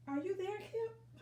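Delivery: high calling voice, reaching up into the tree; high, calling out for a hidden friend. Acted. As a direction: call up the tree to a lost friend. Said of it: "called Watson".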